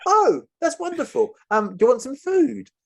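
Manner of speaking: high, cheery mock host offering food; cheery captor. Captor offering the hostages food, high and cheery. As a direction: chirp the offer like an overfriendly host.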